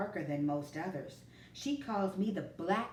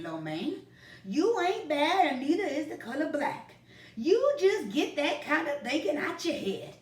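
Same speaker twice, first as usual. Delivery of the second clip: put-on louder, firm grandpa setting the boy straight; 9 semitones higher, 9 dB louder than usual. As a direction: put your foot down kindly, like a grandpa. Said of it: "Grandpa said firmly".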